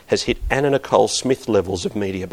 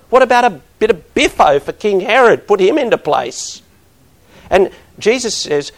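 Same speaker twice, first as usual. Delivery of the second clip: higher and louder, ringing out each blessing; impassioned preaching; 8 semitones higher, 6 dB louder than usual. Acted. Pastor contrasting judgment with blessing, preaching at full force. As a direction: hammer the word blessing home.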